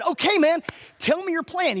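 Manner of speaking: high, loud and keen; pumped-up retold question; acted eager reply. Acts out his keen reply.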